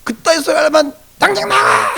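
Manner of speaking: high-pitched hammed-up angry yell; exploding in exaggerated outrage; mock furious outburst. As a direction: act someone hopping mad, throwing a person out. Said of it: "펄펄 뛰면서".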